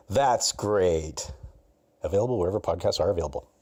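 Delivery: loud, rushed disclaimer read; mock ad tag line. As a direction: rattle off the closing like fine print.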